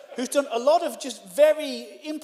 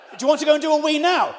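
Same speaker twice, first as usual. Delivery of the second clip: raised voice, higher and faster; louder, quicker, higher insistent question; exasperated; 5 semitones higher, 6 dB louder, faster than usual. Retold or imitated. Guest pressing host about the toilet, exasperated.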